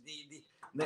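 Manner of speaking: ordinary talk, nothing marked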